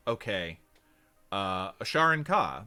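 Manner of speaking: usual speaking voice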